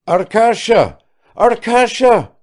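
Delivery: high, loud cry of a name; joyful cry. A narrator's reading. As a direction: call out to your son across the road. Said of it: "cried Kirsanov".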